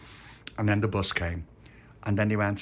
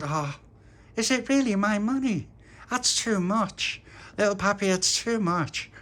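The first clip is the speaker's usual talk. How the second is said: Tony's voice; 12 semitones higher, 3 dB louder, slower than usual. Acted. Tony overwhelmed by birthday money.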